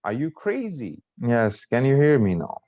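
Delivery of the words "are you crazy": crazy pitched high, then falling away; demonstrated yes-or-no question. Shown as a demonstration. Teacher repeats a yes or no question, high swing on crazy. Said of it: "the answer to this question is yes or no".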